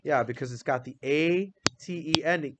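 usual speaking voice

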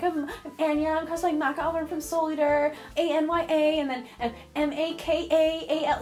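high, stiff recital into a camera; awkward teen self. Retold or imitated. Shy teen giving her name for the news, awkward recital. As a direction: imitate a nervous kid spelling carefully. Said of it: "I was very shy and awkward".

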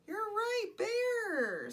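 high, stretched-out exclamation; gasp, then ring out high with delight; delighted agreement. Pretend. Storyteller praising children's guess, delighted. Answering children watching, mock surprise.

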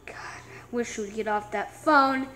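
low, breathless whisper; whispered aside. Acted. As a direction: grumble it so she can't hear. Grumbling aside behind the date's back.

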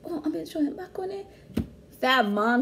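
ordinary talk, nothing marked

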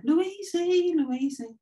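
high sing-song call; mother calling her little one; calling her daughter. Retold or imitated. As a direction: call out to the little girl fondly. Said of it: "and I called her".

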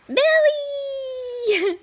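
high playful squeal of belly; excited squeal. Real, not acted. Mom tickling her toddler, excited squeal.

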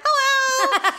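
high, laughing nerdy greeting; a light imitation of a goofy nerd saying Hello; nerdy Ned Flanders hello. Retelling her dentist's greeting, nerdy and high. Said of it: "He's like Ned Flanders".

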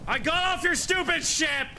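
high, yelling in outrage; furious shout. Real, not acted. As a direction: scream it at the griefer. Raging at griefing player.

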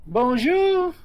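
high, loud French hello; French bonjour. Acted. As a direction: sing out a French greeting. French bird greeting in character.

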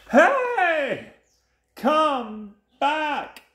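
high, loud, stretched shout; hammed-up indignant shark call; calling after. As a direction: yell after fleeing prey. Shark calls after fleeing fish.

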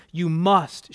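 ordinary delivery

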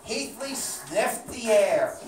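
usual speaking voice

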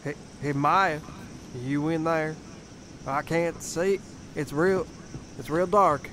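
calling out. Acted. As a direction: holler for someone inside. Calling into a dark house.

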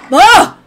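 sharp loud high yelp; startled high yelp; sudden yelp. Real, not acted. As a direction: let out a startled high yell. Sudden scream at game.

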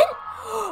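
sharp high shocked gasp; a real gasp of shock. Real, not acted. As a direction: gasp in disbelief. Shocked at winning race.

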